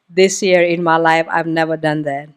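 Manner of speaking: ordinary talk, nothing marked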